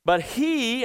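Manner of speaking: "he" lifted high and loud; emphatic he. Shown as a demonstration. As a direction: throw all the weight on "he". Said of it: "this is also emphatic in the Greek text".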